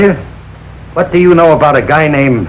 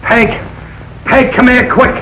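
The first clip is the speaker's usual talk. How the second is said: yelling for Peg; 9 semitones higher, slower than usual. Acted. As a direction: shout for his wife to come quick. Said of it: "What are you yelling about?".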